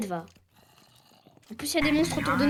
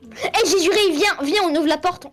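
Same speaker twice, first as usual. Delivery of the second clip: loud, high, urgent urging; appel pressant; 5 semitones higher, 6 dB louder, slower than usual. Real, not acted. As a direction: urge a teammate to come to the door. Presse son coéquipier de venir. (appel pressant, fort)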